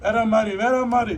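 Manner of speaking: loud, high exclamation of delight; excited rave. Real, not acted. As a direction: call it out loud, raving about the food. Raving about the food's taste.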